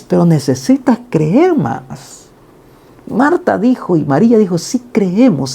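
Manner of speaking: a lightly voiced patient Jesus; Jesus speaking. Preacher voices Jesus gently correcting.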